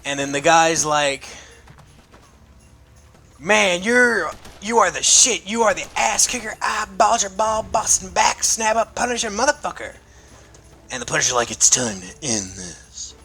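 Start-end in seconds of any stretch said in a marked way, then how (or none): 3.4-10.0 a higher, excited fanboy thug voice; admiring thug. Retold or imitated. Thug gushing to the Punisher, in a higher hyped voice. 11.5-13.1 lower, slow grim vigilante voice; grim Punisher. Retold or imitated. Punisher answering the thug, low and slow.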